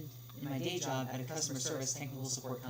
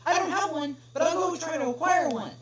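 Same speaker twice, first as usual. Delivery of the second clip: higher, louder nervous clerk voice; flustered pony clerk; 12 semitones higher, 11 dB louder than usual. Acted. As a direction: fluster and promise to find it.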